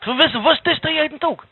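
high-pitched, pressing question; insistent questioning. Acted. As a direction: demand a true answer, pitched well up. Rebbe presses the same question.

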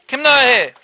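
ordinary delivery, nothing marked

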